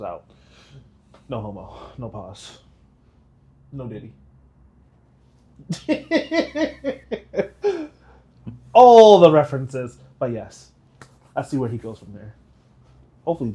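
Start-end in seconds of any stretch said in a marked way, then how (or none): 5.6-8.1 high staccato giggle; loud, high-pitched run of giggles; real high giggling fit. Real, not acted. Joking about references.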